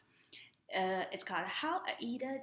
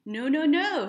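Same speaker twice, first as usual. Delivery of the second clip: loud scolding no no no; a mother's loud scold; 5 semitones higher, 9 dB louder than usual. A narrator's reading. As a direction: scold her like a stern mother. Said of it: "Aida's mother scolded".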